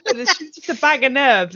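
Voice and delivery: normal talking voice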